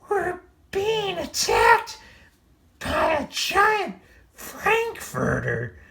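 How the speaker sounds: very high, slow wailing cry; frightened cats. A narrator's reading. Cats fleeing the giant frankfurter, very high. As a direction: wail in disbelief and fear. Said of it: "cried the cats".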